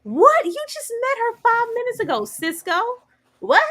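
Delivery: shocked high-pitched what, then trailing; incredulous what. Retold or imitated. To Cisco, mock outrage at the new man. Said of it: "It's like".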